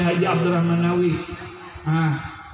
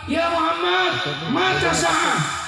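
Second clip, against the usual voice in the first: very high, abrupt call; imitated shout; 14 semitones higher, slower than usual. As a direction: mimic the villager hollering with no greeting. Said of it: "tiba-tiba teriak".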